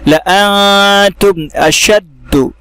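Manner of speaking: लअंतुम अशद्दु recited slowly, no alif after ला; Quranic recitation. Shown as a demonstration. Teacher modelling the phrase, slow recitation. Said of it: "ला अंतुम पढ़ेंगे बगैर अलिफ के".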